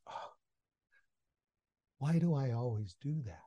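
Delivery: John alone at home, softly regretting his outburst; regretful. Retold or imitated. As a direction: kick yourself quietly.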